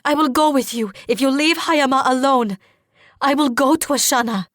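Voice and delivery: high, loud urgent pleading; desperate plea. A narrator's reading. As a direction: plead loudly for someone's life. Desperate plea to spare brother.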